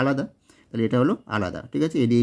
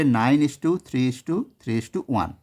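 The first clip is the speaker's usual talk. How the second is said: slow dictation; much slower than usual. Shown as a demonstration. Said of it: "সেটা হবে".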